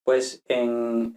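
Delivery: ordinary talk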